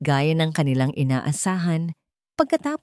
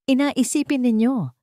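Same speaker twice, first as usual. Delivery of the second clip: insisting; 8 semitones higher than usual. Acted. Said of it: "giit ni Dalisay".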